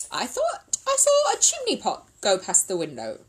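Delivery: a touch of alarm; astonished exclamation. Acted. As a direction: exclaim at a baffling sight. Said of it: "exclaimed Missus Bird".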